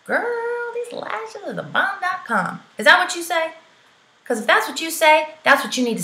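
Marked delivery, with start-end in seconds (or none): 0.0-2.6 put-on high, gushing girl-talk to your friends; a bubbly girlfriend chatting; sassy girlfriend voice. Shown as a demonstration. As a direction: talk like you're hyping your bestie. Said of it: "How do you talk?".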